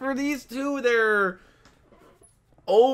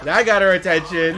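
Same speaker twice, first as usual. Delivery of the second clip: usual speaking voice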